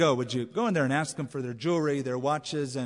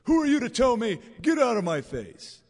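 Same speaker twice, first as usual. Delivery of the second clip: higher and louder, snapping back in defiance; a sinner throwing off rebuke, higher and louder; defiant retort; 6 semitones higher, 6 dB louder than usual. Retold or imitated. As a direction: shove away anyone who corrects you, in a loud raised voice. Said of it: "they sound often like Pharaoh".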